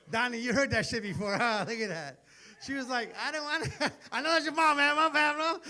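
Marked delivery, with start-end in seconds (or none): 2.6-5.6 cracking up mid-sentence; laughing through the words. Real, not acted. Laughing at own joke.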